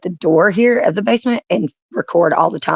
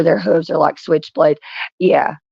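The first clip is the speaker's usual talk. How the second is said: usual speaking voice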